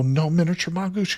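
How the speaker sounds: normal talking voice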